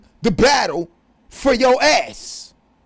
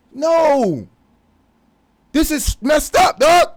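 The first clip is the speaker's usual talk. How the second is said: slow, higher, much louder shout; outraged shout; 5 semitones higher, 5 dB louder, much slower than usual. Real, not acted. Telling listeners his family never calls, loud outraged shout. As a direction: let the disbelief burst out loud.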